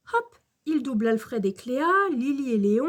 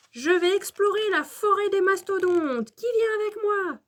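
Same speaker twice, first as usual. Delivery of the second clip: put-on high, excited child's voice; rally friends for an adventure; jeune aventurier enthousiaste; 8 semitones higher than usual. A narrator's reading. Excited young dinosaur rallies friends. (voix aiguë, pleine d'entrain)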